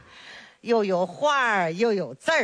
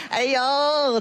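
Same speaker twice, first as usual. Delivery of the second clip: ordinary talk, nothing marked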